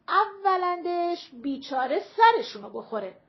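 loud, high-pitched indignant tirade; heated retort. A narrator's reading. Mother interrupting the older woman, heated retort. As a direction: cut in and argue back point by point.

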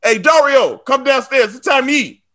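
high, loud mom calling downstairs. Retold or imitated. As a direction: holler it like his mom. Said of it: "My mom goes".

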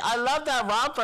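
admiring woman. Retold or imitated. Imitating a woman praising an outfit, admiring woman. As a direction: pitch it up for her gushing compliment. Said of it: "this straight bitch was like".

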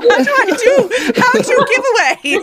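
very high, laughing mock panic. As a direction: whine it in pretend despair. Mock despair over giveaways.

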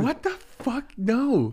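high-pitched shocked refusal; shocked disbelief. Retold or imitated. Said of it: "And I'm like".

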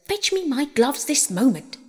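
mildly bossy demand; peremptory order. A narrator's reading. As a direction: order a servant about. Rabbit orders his maid outside.

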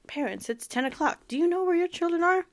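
high, fast mock PSA announcer voice. She imitates the old TV warning to parents in a high, fast announcer voice. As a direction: rattle it off like a late-night TV warning. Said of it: "that thing that they put on T V".

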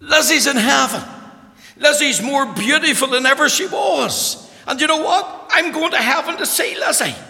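high, loud, exultant cry; joyful shouting. Retold or imitated. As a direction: shout the good news with ecstatic joy. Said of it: "And he said".

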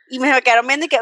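ordinary talk

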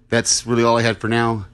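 normal talking voice

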